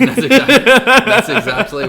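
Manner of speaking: laughing loudly while talking. Real, not acted. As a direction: burst out laughing through the words. Laughs at goat answer.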